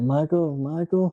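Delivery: mock call for a friend; imitated calling out. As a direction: call out lightly, as if looking for someone. Retold friends calling for Michael.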